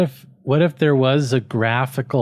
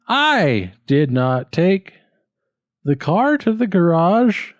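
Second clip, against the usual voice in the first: "car" pitched higher with a rise; slow, rising on CAR; 6 semitones higher, slower than usual. Shown as a demonstration. Student tries a new stress. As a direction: drag "I did not take", then lift "car". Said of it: "or should it be".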